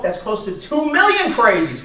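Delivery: ordinary talk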